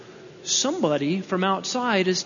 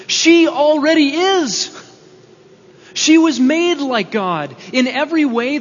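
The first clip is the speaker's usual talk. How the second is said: emphatic declaration; 6 semitones higher, 6 dB louder than usual. Real, not acted. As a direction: proclaim it as the great reveal. Climactic sermon point.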